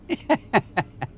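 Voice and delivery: high gleeful snicker; high, sly villain's chuckle; wicked little laugh. A narrator's reading. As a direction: laugh like the trap just snapped shut. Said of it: "Rumpel said with a laugh".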